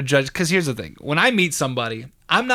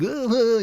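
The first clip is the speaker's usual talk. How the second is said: grumpy groan; 8 semitones higher than usual. Retold or imitated. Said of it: "I'm kinda like".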